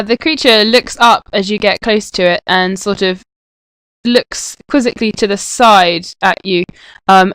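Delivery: ordinary talk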